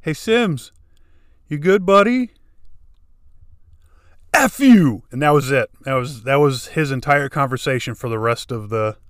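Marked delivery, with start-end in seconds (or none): 0.0-2.3 higher, louder buddy check-in; hammed-up friendly check on a teammate; mock concern. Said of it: "he says". 4.3-5.0 much higher and louder furious shout; retold furious yell at McCann; angry snap. Angry retort through headset.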